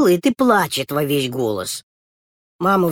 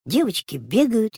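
plain reading voice

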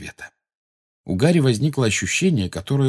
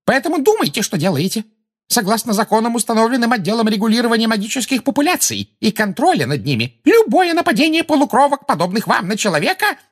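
very high, hysterical official lecture; high shriek; 14 semitones higher, 4 dB louder than usual. A narrator's reading. Umbridge threatens the centaurs with the law, high shriek. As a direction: squeal the law at them. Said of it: "провизжала Амбридж на ещё более высокой ноте".

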